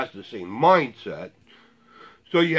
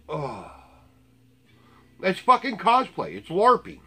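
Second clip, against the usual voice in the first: ordinary delivery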